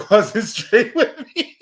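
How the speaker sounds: laughing through the words, slow. Real, not acted. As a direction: give in to the laugh as you say it.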